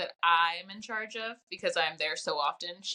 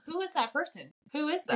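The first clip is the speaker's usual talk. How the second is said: raised-pitch imitation of baffled friends; mock puzzled friends; 7 semitones higher than usual. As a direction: question it in mock disbelief. Voicing friends puzzled by fake voice.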